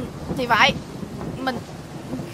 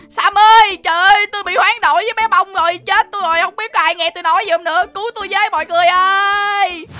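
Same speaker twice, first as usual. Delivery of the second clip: higher-pitched, distressed pleading; calling for help; 6 semitones higher, 4 dB louder than usual. Acted. Swapped man begging Sammy for help, distressed calling. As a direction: call desperately for rescue.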